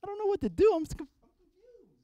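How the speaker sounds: high, flustered confused voice; lost on the dance floor, panicking; confused panic. Retold or imitated. Comic himself lost in the club, high flustered panic. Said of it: "I'm like".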